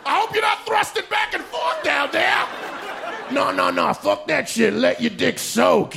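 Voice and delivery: high, shouting, laughing voice; hammed-up preacher egging them on; God as a creepy cheerleader; mock God. Mocking God's rule.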